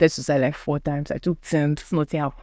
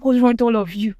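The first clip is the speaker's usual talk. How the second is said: higher, louder retold yell; angry outburst; 8 semitones higher, 3 dB louder than usual. Shouting at everyone banging on her door, angry. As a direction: snap it at the people at the door. Yelling at people knocking.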